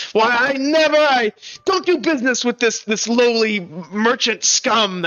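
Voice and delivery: huffy, affronted bluster; mock outrage. Minstrel backs away from lowly merchant, mock outrage. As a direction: sputter with hammed-up disdain, drawing out the end.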